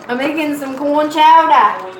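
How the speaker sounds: put-on country accent for the live viewers. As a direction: say I'm making some corn chowder in a big folksy country accent. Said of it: "Country accent".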